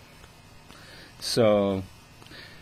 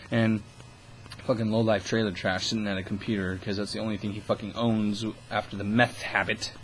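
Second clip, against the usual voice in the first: ordinary delivery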